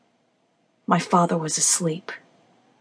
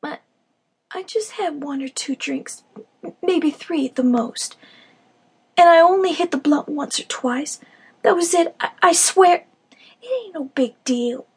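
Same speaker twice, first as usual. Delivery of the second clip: mumbling; 7 semitones higher, 3 dB louder than usual. Acted. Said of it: "mumbled through her busted upper lip".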